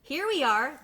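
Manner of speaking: a hint of little ducks calling up; answering ducklings. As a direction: call up cheerfully to the lost ones. Siblings answer from below.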